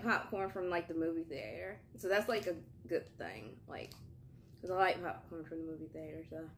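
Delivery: speaking between bites; talking while chewing. Real, not acted. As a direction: mouth partly full of snack.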